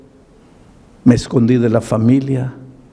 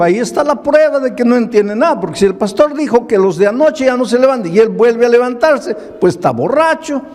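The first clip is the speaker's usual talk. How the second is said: mocking crowd; 10 semitones higher than usual. Crowd mocking the drunk man.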